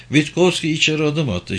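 usual speaking voice

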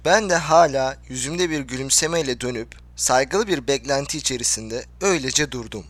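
ordinary delivery, nothing marked

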